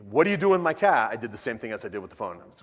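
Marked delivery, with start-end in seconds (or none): 0.1-1.1 loud, higher outraged demand; a friend demanding his stolen car back, loud and indignant. Retold or imitated. Friend finds his car taken.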